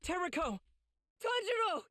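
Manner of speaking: urgent high calls; calling out. Acted. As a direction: call each name out, pitched high. Calling out for the kids.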